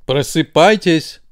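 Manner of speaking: a brain insisting the eyes open; firm wake-up order; demanding. A narrator's reading. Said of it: "требовал мозг".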